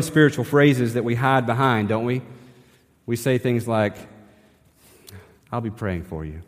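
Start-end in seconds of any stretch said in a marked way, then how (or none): 5.5-6.4 softer and lower, an empty I'll be praying for you; hollow promise. Retold or imitated. Pastor imitating churchgoers' empty promise, softer and lower. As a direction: mumble the stock phrase without meaning it. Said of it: "And sometimes we mean it".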